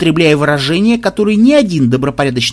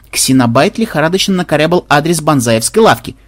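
plain reading voice

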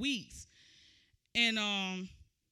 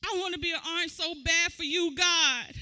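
ordinary delivery

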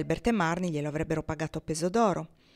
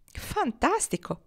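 "Fantastico!" esclamato acuto e lento; esclamazione entusiasta; 9 semitones higher, slower than usual. A narrator's reading. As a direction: Marnie esulta trascinando la parola. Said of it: "esclamò Marnie". (Marnie esclama la notizia, entusiasta)

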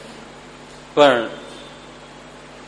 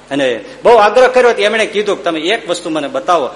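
usual speaking voice

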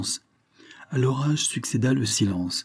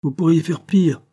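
high, louder, tart reply; sour retort; 7 semitones higher, 3 dB louder than usual. A narrator's reading. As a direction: bite back sourly at her. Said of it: "dit aigrement Cornélie". (réplique aigre, plus haute et plus forte)

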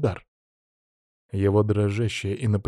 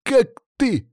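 very high, slow outrage; furious snarl; 12 semitones higher, slower than usual. A narrator's reading. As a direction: stammer it out in fury. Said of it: "прорычал он".

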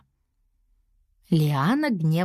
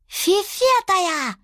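high, drawn-out garbled word; babyish character voice; 12 semitones higher, much slower than usual. A narrator's reading. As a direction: squeak it slowly like a toddler. Voice of little character Aš prompting. (детским голоском, коверкая слово)

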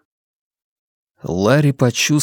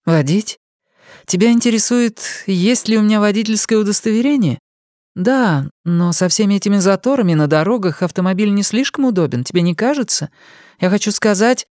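higher, lighter female voice, chatty and reasoning; young woman's voice; 9 semitones higher than usual. A narrator's reading. Young woman answers his question about driving, chatty and light. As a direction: play her as a breezy young woman thinking aloud.

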